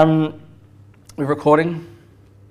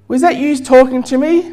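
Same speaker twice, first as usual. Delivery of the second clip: higher-pitched, acted puzzled question; questioning burglar; 8 semitones higher, 3 dB louder than usual. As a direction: lightly play a puzzled burglar asking. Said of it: "he says to the parrot".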